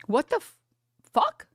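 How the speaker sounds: shooting up high on the last word; shocked outburst. Retold or imitated. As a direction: burst out in disbelief. Amazed outburst.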